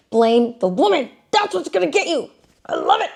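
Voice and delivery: high, put-on mock glee; sarcastic. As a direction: cheer it on with obvious irony. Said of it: "you know I'm being sarcastic".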